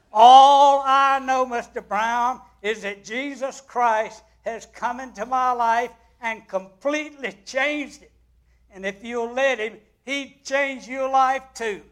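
put-on young nurse answering the bitter patient with fervent conviction; passionate testimony. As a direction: pour it all out at him, voice rising.

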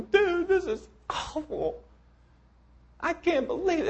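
put-on high, whining boy griping about his toys; squeaky complaining child; whiny kid voice. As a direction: whine like a spoiled kid. Pessimist kid complains in story.

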